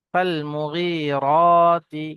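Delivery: Quran recitation. Shown as a demonstration. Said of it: "teacher recites the verse".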